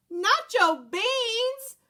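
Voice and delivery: high, slow outraged cry. Acted. Scipito crying out over the stolen beans, outraged. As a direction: drag out the words in outrage. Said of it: "cried Scipito, outraged".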